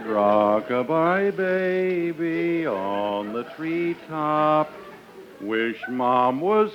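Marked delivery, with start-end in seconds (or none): none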